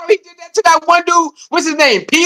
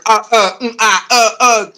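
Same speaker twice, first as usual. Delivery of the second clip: glitching stammer; mock stuttering; 4 semitones lower than usual. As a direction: stutter like a broken bot. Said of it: "they start stuttering".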